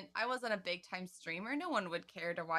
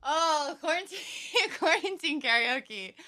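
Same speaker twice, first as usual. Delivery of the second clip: higher, louder words broken by a laugh; 8 semitones higher, 7 dB louder than usual. Real, not acted. Friend asks Sarah about quarantine karaoke, laughing higher and louder. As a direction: giggle through the title.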